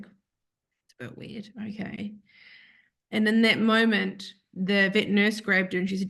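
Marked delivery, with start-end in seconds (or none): 0.0-2.1 whisper. Retold or imitated. Her stunned reaction to the vet.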